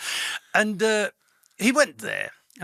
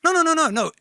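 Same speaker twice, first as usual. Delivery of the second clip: high, louder rapid string of no; retold insistent correction; emphatic denial; 12 semitones higher, 5 dB louder, faster than usual. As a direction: brush off the wrong guess eagerly. Friend correcting the German doctor.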